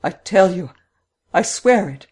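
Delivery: pleading insistence from a cornered housekeeper; insistent housekeeper. A narrator's reading. Cornered housekeeper protests to investigator.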